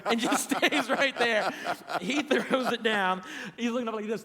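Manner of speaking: cracking up mid-story; laughing. Real, not acted. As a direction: struggle to get the words out for laughing. Laughing at his own prank story.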